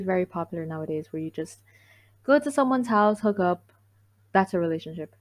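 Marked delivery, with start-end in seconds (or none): none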